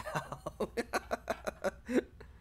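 high airy silent laughter; real breathy giggle; breathless laugh. Real, not acted. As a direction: wheeze with laughter. Laughing continues.

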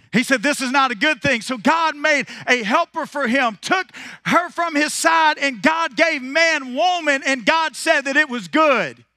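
high, loud, ringing preacher's cadence; preaching at full force. Acted. Preacher firing up the congregation, full-force preaching. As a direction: preach it out to the whole room.

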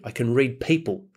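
ordinary talk, nothing marked

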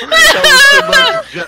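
high shrieking laughter; laughing hard. Real, not acted. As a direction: laugh through the words. Cracking up after horse crash.

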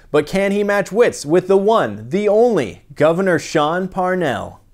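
slow, loud, building announcer hype; hyped introduction. Acted. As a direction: sell the big entrance like a ring announcer. Host hypes the governor's entrance.

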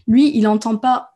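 ordinary delivery

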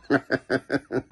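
short chuckle at own pun; corny dad laugh. Real, not acted. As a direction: chuckle at your own joke.

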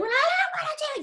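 very high-pitched retold tantrum wail; mock screaming, crying. Young pop singer refusing work, in a mock crying scream. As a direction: imitate an overwrought, dramatic screaming protest. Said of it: "literally be screaming and crying".

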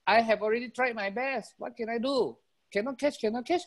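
high-pitched defensive wolf; acted whiny excuse; helpless excuse-making.

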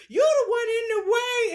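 high, amused shout at the cat; mock protest. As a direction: shout back in playful indignation. Mock arguing back at cat.

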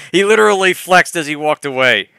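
ordinary talk

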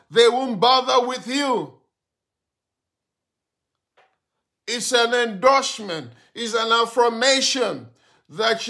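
loud, high, driving sermon; fiery preaching. Real, not acted. Pastor preaching to a weary believer, full force.

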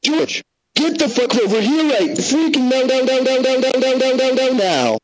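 shrill-free furious repeated now; furious yelling parent. Acted. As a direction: scream for him to come now. Parent furious at son George.

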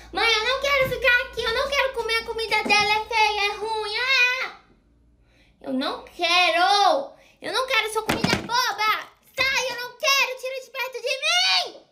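high whining brat; whiny tantrum. Acted. Little girl refusing the food, high whiny tantrum. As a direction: fuss like a cranky toddler.

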